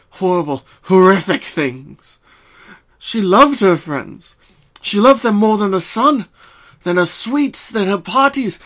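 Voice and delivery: storyteller pouring her heart into the reading; impassioned narration. A narrator's reading. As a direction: let the narration swell with aching love for her friends. Narration swells with love, anguish.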